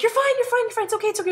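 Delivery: high, loud imitation of a panicky friend; frantic reassurance. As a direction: blurt repeated reassurance in mock alarm. Friend reassuring driver in scary moment.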